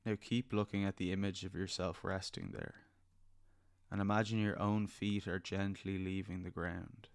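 soft spa whisper. Acted. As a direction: gently lead them into calm. Leads a guided meditation.

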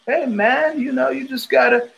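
high, put-on hippie voice. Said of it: "giving me these hippieisms".

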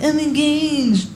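slow, high, drawn-out "I'm engaged"; smug engaged friend. Retold or imitated. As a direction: stretch it out high like a smug bride-to-be. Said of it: "That's how they say it".

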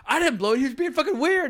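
high, loud defensive protest; indignant retort. Retold or imitated. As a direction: protest loudly that it wasn't your fault. Retelling defensive retort to friend.

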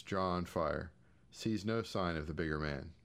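ordinary delivery

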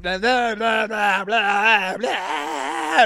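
wailed nonsense, imitating a crying drunk; mock yelling and crying. Drunk caller hearing he gets no ride, mock crying. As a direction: blubber it mockingly, rising at the end. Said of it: "yelling and crying simultaneously".

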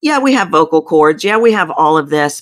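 usual speaking voice

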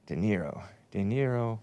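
very soft, absent mutter; half-aloud, as if looking it up; muttering to himself. Real, not acted. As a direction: murmur the name under your breath. Muttering the name while checking.

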